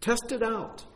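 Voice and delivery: very high, agreeable seller's voice; high-pitched, hammed-up yes to a customer; eager donkey seller. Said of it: "the man selling the donkey says".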